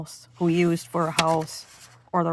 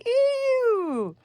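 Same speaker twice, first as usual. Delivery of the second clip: long, much higher eeew squeal; hog scream. Acted. The sprayed hog squeals a long high eeew. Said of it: "who screamed".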